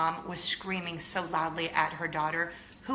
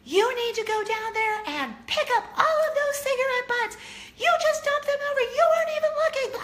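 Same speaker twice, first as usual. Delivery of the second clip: much higher, louder mock scolding, fast at the end; mother screaming; 5 dB louder than usual. Mother yelling at grown daughter, high, loud mock scolding. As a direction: tear into her like a furious mom. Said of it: "She was screaming at her daughter".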